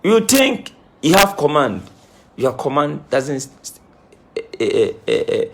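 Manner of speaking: usual speaking voice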